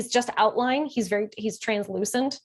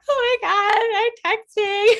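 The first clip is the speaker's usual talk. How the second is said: much higher and louder, teary; tearful squeak; 13 semitones higher, 7 dB louder than usual. Retold or imitated. Texting her best friend, crying and overwhelmed. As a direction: cry it out half-laughing.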